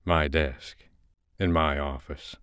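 ordinary delivery, nothing marked

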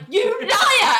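high-pitched indignant outburst; mock accusation. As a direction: fling the accusation, hammed-up. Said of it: "And he's like".

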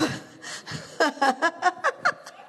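real laugh. Real, not acted. Laughing at her husband's reaction. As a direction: laugh after the joke.

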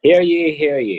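booming hear ye, laughing; town crier. Pretend. Mock town crier after scroll joke.

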